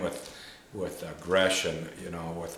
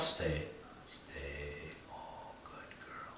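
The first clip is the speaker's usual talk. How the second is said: soft, slow whisper to a puppy; gentle whisper; 4 semitones lower, 13 dB quieter, much slower than usual. Shown as a demonstration. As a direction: hush it gently so she stays. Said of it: "I'll whisper to 'em".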